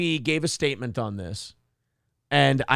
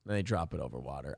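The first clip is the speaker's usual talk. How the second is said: much softer, fast repeat under the breath; quick quiet aside; 14 semitones lower, 13 dB quieter, faster than usual. Real, not acted. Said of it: "we can't show it to you guys".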